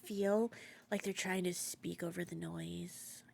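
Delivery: ordinary delivery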